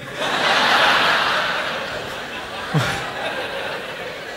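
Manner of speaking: breathy laugh at his own joke; long laugh. Real, not acted.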